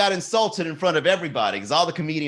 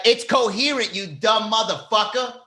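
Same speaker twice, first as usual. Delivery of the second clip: high-pitched mock yell from the back; imitated heckler yell; 7 semitones higher than usual. Comedian correcting host from the back, mock yell. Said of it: "He just yells in the back".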